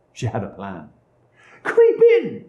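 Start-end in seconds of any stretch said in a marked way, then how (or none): 1.6-2.5 very high witch voice; commanding witch. A narrator's reading. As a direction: order her in as a shrieky witch. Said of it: "said the witch".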